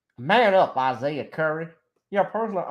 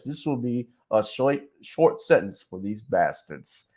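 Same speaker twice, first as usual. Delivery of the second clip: a hint of buttoned-up TV news voice; 6 semitones lower, 3 dB quieter than usual. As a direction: imitate a stiff corporate anchor. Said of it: "such the whitest voice".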